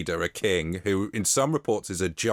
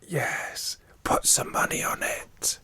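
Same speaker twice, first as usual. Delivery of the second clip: higher, hushed whisper; 5 semitones higher, slower than usual. Pretend. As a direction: whisper along, conspiratorially. Joins the whispering knights bit.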